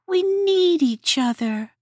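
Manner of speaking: insist, heart on sleeve; earnest Hedgehog. A narrator's reading. Hedgehog's character voice.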